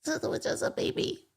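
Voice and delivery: soft, slow, high whisper; whispered baby talk. Retold or imitated. Teasing the older man, whispering about a baby. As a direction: coo it breathily like over a crib.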